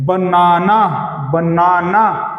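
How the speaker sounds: Banana drawn out slowly on the middle ना; stress on middle ना. Shown as a demonstration. Teacher showing students the stressed syllable, middle ना of banana leaned on. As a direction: stretch and press the middle ना in banana. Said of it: "बीच में जो ना है".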